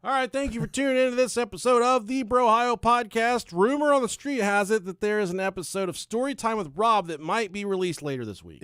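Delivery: loud, high announcer's outro; projected host sign-off; podcast sign-off. Real, not acted. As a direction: announce the show's close with big projection.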